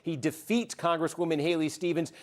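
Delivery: calm, formal anchor read; serious newscaster voice. Acted. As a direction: deliver it like a news bulletin. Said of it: "I'm gonna put on the serious newscaster voice here".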